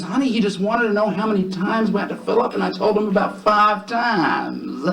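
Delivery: high, stretched-out call to his wife; husband relaying to wife. Acted. Husband calls answer to yelling wife.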